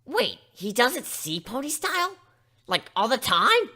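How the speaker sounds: amazed question. Acted. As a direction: blurt it out in disbelief. Dash amazed.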